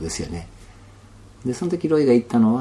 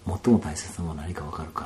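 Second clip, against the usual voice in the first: hushed question; 4 semitones lower, 7 dB quieter than usual. Retold or imitated. Repeats the elder's question hushed.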